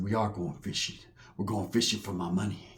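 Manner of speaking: low, soft, ominous reply; menacing tough guy. Retold or imitated. Big guy correcting his fishing buddy, low menace. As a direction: say it low and quiet, like a veiled threat.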